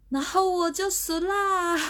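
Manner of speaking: high squeaky giggle through the words; sheepish high-pitched laugh; squealing laugh. Real, not acted. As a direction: laugh it off, embarrassed. Embarrassed at losing her nerve.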